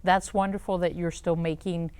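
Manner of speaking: normal talking voice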